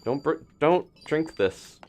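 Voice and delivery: ordinary talk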